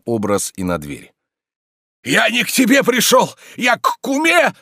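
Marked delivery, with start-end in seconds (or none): 2.0-4.5 very high, loud protest; indignant yelling. Acted. Tarantyev refuses to be thrown out, indignant yelling. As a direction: bawl it in outrage. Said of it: "вопил Тарантьев".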